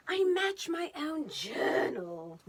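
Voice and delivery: slow, posh-sounding British drawl with pinkies out; fancy British accent. Pretend. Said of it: "That's the fancy British accent".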